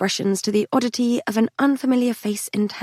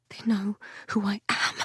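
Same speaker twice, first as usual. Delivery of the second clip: soft unvoiced whisper with dread; frightened whisper; 8 dB quieter than usual. A narrator's reading. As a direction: whisper it, scared they'll hear. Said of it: "I whispered to Patrick, my voice wavering".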